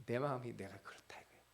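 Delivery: a hushed, intimate whisper of God's heart. Acted. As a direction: whisper it as God confiding his grief.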